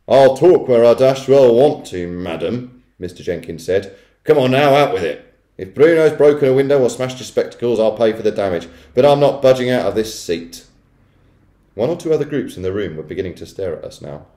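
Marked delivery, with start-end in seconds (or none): 0.1-10.6 loud, blustering refusal; forceful, irritated bluster; Mister Jenkins, stubborn. A narrator's reading. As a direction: play an obstinate man digging in.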